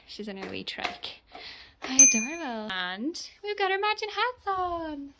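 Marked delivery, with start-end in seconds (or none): 1.8-5.1 high, loud, gushing cheer. Real, not acted. Mother setting out for a walk with baby, excited baby talk. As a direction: cheer for a little one, pitched up.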